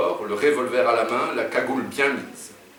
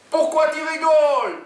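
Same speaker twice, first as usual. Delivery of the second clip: very high-pitched loud demand; high yelling; 7 dB louder than usual. A narrator's reading. Oumar takes his turn at the laughing woman, yelling. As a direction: yell it in a high sidekick voice. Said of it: "hurle Oumar à son tour".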